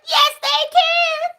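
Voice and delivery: high, slow, loud yes they can; drawn out with bitter emphasis; hammered home refrain; emphatic refrain. Real, not acted. Ironic refrain, third time.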